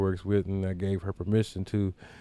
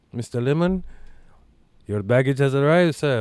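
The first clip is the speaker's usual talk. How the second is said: higher, louder imitation of hotel staff; hotel caller; 7 semitones higher, 6 dB louder than usual. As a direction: announce it like a front-desk clerk on the phone.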